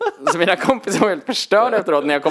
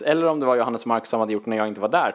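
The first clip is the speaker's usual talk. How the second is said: normal talking voice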